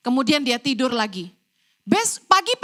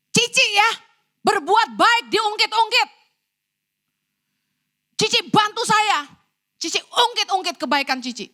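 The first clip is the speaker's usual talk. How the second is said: mock-fierce accusation in a higher voice; retold indignant retort; 7 semitones higher than usual. Nanny answers back at her employer, mildly fierce and indignant. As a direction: imitate the nanny talking back, pitched up. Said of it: "Malah galakan dia".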